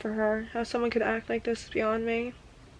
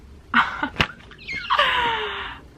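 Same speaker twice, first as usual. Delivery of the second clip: high laugh; 14 semitones higher than usual. Real, not acted.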